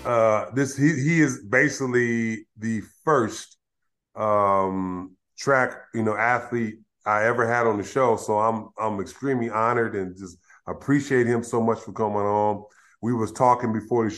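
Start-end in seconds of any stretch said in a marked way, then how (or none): none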